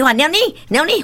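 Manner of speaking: put-on high-pitched toddler calling a cat; imitated delighted child. Grandmother imitating grandchild spotting a cat, playfully excited. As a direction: mimic a little child's happy cat call. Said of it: "做欢喜".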